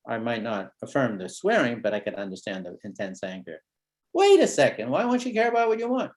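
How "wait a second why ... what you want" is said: much higher, louder puzzled start; storytelling surprise. Shown as a demonstration. Therapist drawing the kid out, mock surprised. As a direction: sound struck by it, then ask. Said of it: "notice the storytelling".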